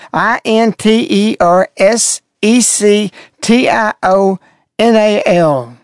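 slow mocking spell-out, each letter separate. Shown as a demonstration. Mocking the ad, he spells the word slowly. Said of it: "She's intersectional".

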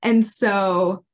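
ordinary talk

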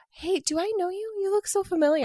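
high, sweet flirty pickup line. Acted. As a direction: bat your eyelashes, coy and high.

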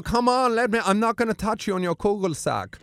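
high camp Austrian reassurance. Retold or imitated. Bruno persuading the wrestler, high camp reassurance. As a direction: coax in a high Austrian accent.

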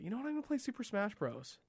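high-pitched retold decision; playful sudden resolve. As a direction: lift it high, as if struck by an idea. Retelling his own sudden gaming decision.

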